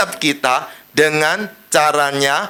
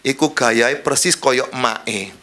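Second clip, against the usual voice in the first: God joking; 6 semitones lower, slower than usual. Retold or imitated. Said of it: "Tuhan cuma guyonan sama malaikat".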